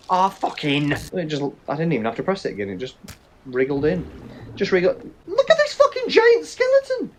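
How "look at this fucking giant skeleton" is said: startled shout. Real, not acted. Player spots a giant skeleton, shouting high in shock. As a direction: yelp it out in shock.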